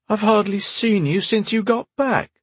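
high whiny younger brother; whining. A narrator's reading. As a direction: whine like a kid. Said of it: "whined his brother".

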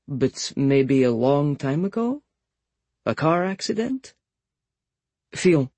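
put-on slow, probing Russian accent, rising high. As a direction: drawl it like a Russian masseuse. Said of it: "She did a Russian accent for the woman".

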